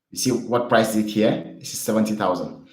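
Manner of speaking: usual speaking voice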